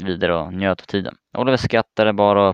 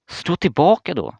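a friend yelling at the kid on the cliff, a higher mock shout; retold shout; 9 semitones higher than usual. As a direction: shout him back, mock yell. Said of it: "skrek Oliver".

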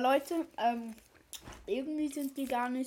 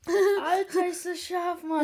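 burning mouth; 5 semitones higher, 5 dB louder than usual. Real, not acted. Eating hot Tabasco chocolate.

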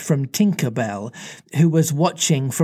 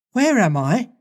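Wendy surprised; 6 semitones higher than usual. Acted. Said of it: "she said".

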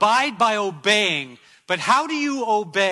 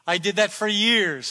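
ordinary talk, nothing marked

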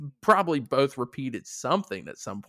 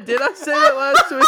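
laughing; 11 semitones higher, 7 dB louder than usual. Real, not acted. Surprised, laughing.

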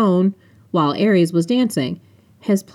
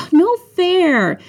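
high, drawn-out whine; very high-pitched sulky protest; whining; 13 semitones higher, 4 dB louder than usual. A narrator's reading. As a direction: whine it like a complaint. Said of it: "Phoebes whined".

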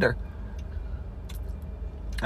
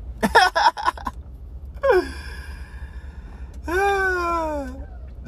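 high laugh; 12 semitones higher, 3 dB quieter than usual. Real, not acted. Laughing at his own story.

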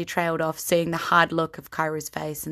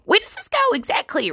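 high gnome question; 4 dB louder than usual. Acted. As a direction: ask it, small and eager. Rusty asks humans.

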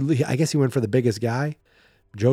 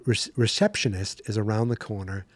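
ordinary delivery

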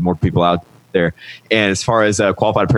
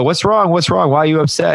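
concerned researchers; 10 semitones higher than usual. Retold or imitated. Researchers checking on the upset boy, high and concerned. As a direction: ask the crying boy what's wrong, pitched well up.

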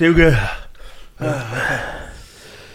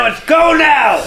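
impatient shout; 6 semitones higher, much slower than usual. Real, not acted. Impatient to continue.

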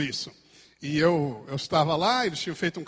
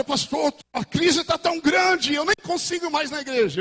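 whiny church member; 9 semitones higher, 4 dB louder than usual. Retold or imitated. Church member complains to the pastor, sing-song. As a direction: whine the complaint. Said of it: "E a outra".

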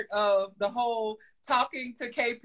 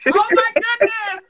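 excited gasp; 12 semitones higher, 9 dB louder than usual. Retold or imitated. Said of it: "I'm like".

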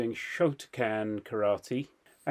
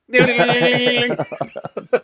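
loud, high sustained alarm ringing; imitated fire alarm; 11 semitones higher, 10 dB louder than usual. Storyteller imitating the delayed alarm, loud high ringing. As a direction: mimic a shrill alarm bell suddenly going off. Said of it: "It was a delayed alarm".

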